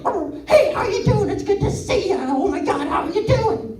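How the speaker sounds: high, chirpy greeting voice; friendly dog. Pretend. Dog greeting another dog, high and chirpy. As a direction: greet an old pal brightly. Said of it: "the dog's just like".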